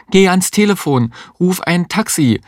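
high-pitched urgent order; acted high, pressing cry. A narrator's reading. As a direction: call out a desperate command. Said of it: "rief die Existenzialistin".

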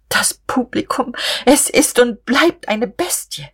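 high, slow, tight-jawed fury at the audience; grinding out in anger. A narrator's reading. Offended poetess grinding out scorn at the audience, high and slow. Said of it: "knirschte sie".

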